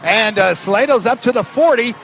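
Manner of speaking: rising, shouting the return as it happens; excited play-by-play. Real, not acted. Live kickoff return call.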